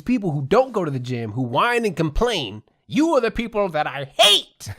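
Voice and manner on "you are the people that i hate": higher, louder mock rant at complainers; a hammed-up grumpy outburst; mock anger. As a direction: playfully vent your anger. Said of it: "but I'm just angry".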